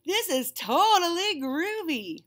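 high, slow laid-back cat voice; groovy cool cat. Acted. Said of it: "Pete the Cat cried".

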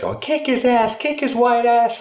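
high, loud mock woman's voice; exaggerated high yell, dragging the last words; egging-on woman. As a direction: goad him on in a high-pitched mimicry. Retold woman egging robber on.

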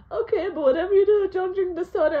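high, loud, fast warning from dad; urgent warning. Retold or imitated. Dad urgently warning kid off the soda. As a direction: rush the warning out in a panic.

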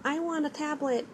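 lightly imitating a child asking; little daughter. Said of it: "my daughter will put".

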